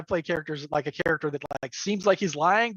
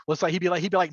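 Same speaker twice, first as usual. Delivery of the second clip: usual speaking voice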